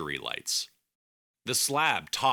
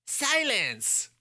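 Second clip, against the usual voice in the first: yelled. Acted. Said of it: "Neil Shemaine yelled".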